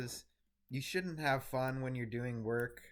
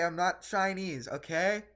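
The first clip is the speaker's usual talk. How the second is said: louder insistence; 8 semitones higher, 6 dB louder than usual. Real, not acted.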